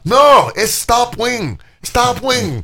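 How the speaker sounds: put-on high, insistent Chinese-accented correction; mock Chinese accent. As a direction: imitate a girlfriend insisting in a loud, high, drawn-out Chinese accent. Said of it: "so she has this funny accent".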